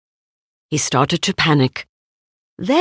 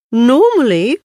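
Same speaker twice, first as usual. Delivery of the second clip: musing Pooh; 6 semitones higher, 4 dB louder than usual. Acted. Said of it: "Pooh mused".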